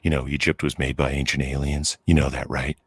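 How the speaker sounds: low dopey stoner bro voice; Joe Rogan impression. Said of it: "I'm Joe Rogan".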